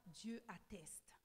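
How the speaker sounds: slow hushed whisper; a slow reverent whisper. Acted. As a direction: breathe it out, slow and secret. Preacher hushes a solemn point.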